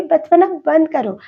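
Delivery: wife scolding her teasing husband, playful; mock scolding. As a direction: snap at him to stop acting childish.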